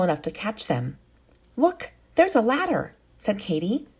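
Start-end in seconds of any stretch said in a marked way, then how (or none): 1.6-2.9 bright excited discovery, higher; pointing it out with glee; excited child. Acted. Katy spotting the ladder, excited high call.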